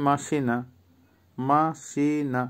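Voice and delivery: MA-SI-NA slowly, syllable by syllable, for মাসিনা; slow pronunciation demo. Shown as a demonstration. Teacher repeating a Russian word for learners, slow and careful. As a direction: draw out each syllable of মাসিনা, softly. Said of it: "উচ্চারণ আবারও বলছি".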